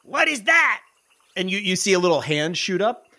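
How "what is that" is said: high squeaky goblin question. Acted. GM echoes goblin voice.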